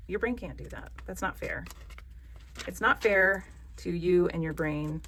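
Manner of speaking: ordinary talk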